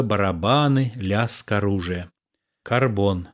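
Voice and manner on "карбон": usual speaking voice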